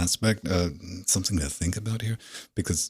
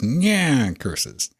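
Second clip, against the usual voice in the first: mustache twirling villain; 7 semitones higher, 5 dB louder than usual. Retold or imitated. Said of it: "the soundbite where he goes".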